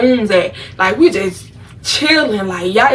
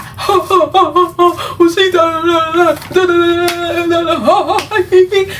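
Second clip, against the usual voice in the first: high, loud mocking cackle with da da da; mocking laughter; 8 semitones higher, 3 dB louder than usual. Imitating friends cracking up, high and laughing. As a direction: friends laughing at her clumsy punch, imitated high and giggly. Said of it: "They're like".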